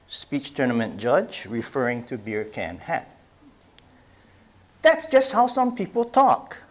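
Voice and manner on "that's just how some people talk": put-on child's voice. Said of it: "Second grader's response".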